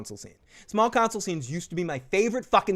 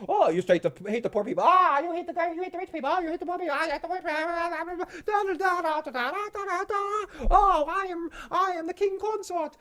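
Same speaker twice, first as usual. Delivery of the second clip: much higher mock bickering voice; hammed-up high squeaky parody of the council; mocking squeaky councillor; 13 semitones higher than usual. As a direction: lampoon the quarrel in a squeaky voice.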